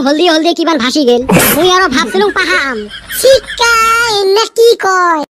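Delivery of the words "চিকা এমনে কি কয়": disgusted yell. Acted. Disgust at dirty river water.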